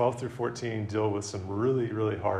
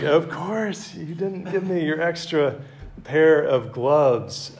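higher, straining, the last words dragged out very slowly; mock furious Harry; 6 semitones higher, 3 dB louder, slower than usual. Preacher retelling the movie, mock outrage. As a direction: ham up a friend's outrage.

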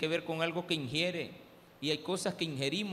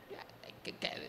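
whispered shrug; 10 dB quieter than usual. Retold or imitated. Shrugging off forgotten daughter.

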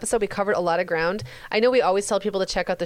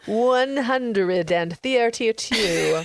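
very slow, hammed-up announcer with stretched syllables; booming voice; 2 semitones higher, much slower than usual. Shown as a demonstration. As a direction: boom it out, drawing out every syllable. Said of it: "say it with that really booming voice".

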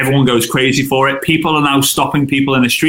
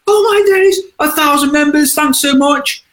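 much higher, gushing thanks; excited celebration; 13 semitones higher than usual. Retold or imitated. Friend thanking his thousand group members, high and gushing. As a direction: gush like you can't believe it.

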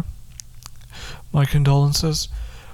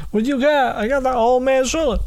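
bragging; 7 semitones higher than usual. Retold or imitated. Bragging quote exchange.

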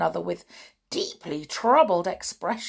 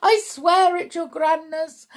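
high frightened pleading; desperate cry; 9 semitones higher, 7 dB louder than usual. A narrator's reading. Wretched witch pleads with her leader, desperate cry. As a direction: swear it in a high panic. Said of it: "cried the wretched witch".

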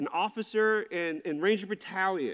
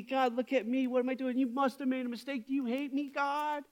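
high, wounded questioning of God; retold anxious prayer, pitched up; fearful pleading; 6 semitones higher than usual. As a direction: act out a self-pitying plea, climbing higher to the end. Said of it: "I felt a lot of fear".

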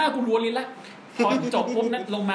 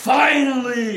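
finally stretched very slowly and louder like a promo; The Rock finally; 4 semitones higher, 7 dB louder, much slower than usual. Shown as a demonstration. Podcaster showing friends how he did The Rock's call, slow and loud. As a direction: drag out finally like a wrestler on the mic. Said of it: "อย่างนี้เลยนะ".

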